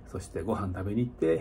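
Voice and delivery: ordinary delivery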